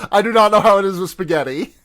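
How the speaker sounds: amused, high-pitched mock protest; laughing retelling. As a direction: act out the line while cracking up. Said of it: "Ethan Hawke says".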